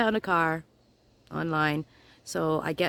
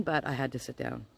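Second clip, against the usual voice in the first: softer, dropped low: but I had to sit down; quiet repeat; 7 semitones lower, 6 dB quieter than usual. Retold or imitated. Repeating it to viewers, quietly deflated. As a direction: let it fall away, hushed.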